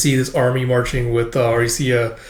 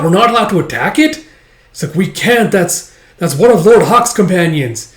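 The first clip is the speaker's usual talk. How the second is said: higher, louder panicked exchange; alarmed shouting; 6 semitones higher, 7 dB louder than usual. Acted. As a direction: shout over the chaos. Arguing soldiers in panic.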